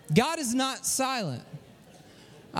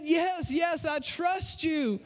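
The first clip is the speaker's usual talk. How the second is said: high, slow, drawn-out cry; mock desperate plea, pitched up without shouting; 5 semitones higher, much slower than usual. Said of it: "cried the man".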